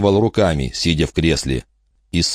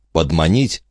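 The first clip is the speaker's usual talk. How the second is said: ordinary talk, nothing marked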